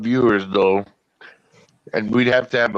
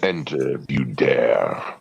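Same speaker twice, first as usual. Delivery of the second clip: low, slow haunted-ride voice; spooky ride narrator; 8 semitones lower, 3 dB quieter, slower than usual. Pretend. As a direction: lure them in, slow and low.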